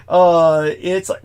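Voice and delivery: usual speaking voice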